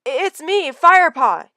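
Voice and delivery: high loud shout to be recognised; pinned cat yelling to his friend; yelled. Acted. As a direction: cry out your own name to stop him. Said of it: "he yelled".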